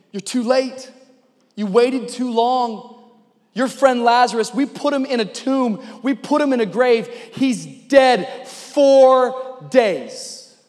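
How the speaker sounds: acted reproach, building in force; upset, frustrated. Martha reproaching Jesus over Lazarus, frustrated. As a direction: press the complaint, stretching the final words. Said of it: "she's frustrated. She's broken. She's saying".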